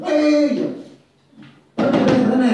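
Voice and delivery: loud, high acted outburst; mock scolding. Father catches child singing in the bathroom, loud mock scolding. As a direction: cut in as an indignant father.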